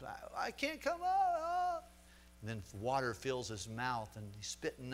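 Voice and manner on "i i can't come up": very high, slow, strained voice; drowning man's last words. Retold or imitated. Pastor voicing the drowning man to his friends, high and slow. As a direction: stretch it out high as the water closes over.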